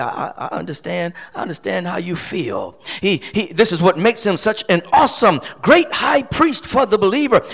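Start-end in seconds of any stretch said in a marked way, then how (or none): 0.0-2.8 soft, low, comforting words; gentle reassurance. Acted. Preacher voicing Jesus relating to us, gentle reassurance. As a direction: say it very softly, with compassion.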